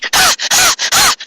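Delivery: put-on high girlish giggle with a rise; a bright little laugh from the group. Girls watching a movie together: high giggle.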